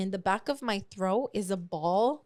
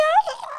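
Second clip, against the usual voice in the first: very high, loud wailing "ah"; drawn-out imitation of bawling; mock crying wail; 7 dB louder than usual. Storyteller reliving tearful panic at home, mock crying wail. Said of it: "I just start crying".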